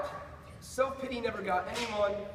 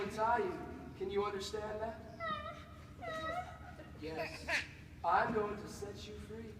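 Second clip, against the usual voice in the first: calm a dangerous creature gently; soothing; 8 dB quieter than usual. Acted. Scientist gently approaches bound creature.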